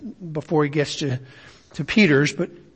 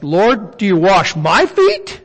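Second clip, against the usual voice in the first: MY FEET hit louder, rising into an incredulous question; emphasis as Peter said it; 2 semitones higher, 3 dB louder, slower than usual. Shown as a demonstration. Preacher reads Peter's words to Jesus with Greek emphasis, questioning stress on MY FEET. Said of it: "try to read this ... how he said it".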